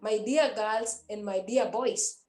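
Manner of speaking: usual speaking voice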